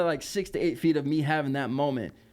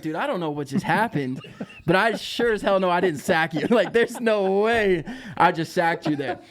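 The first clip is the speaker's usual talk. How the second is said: laughing protest; 5 semitones higher, 5 dB louder than usual. Retold or imitated. Telling Tim he wasn't sacked.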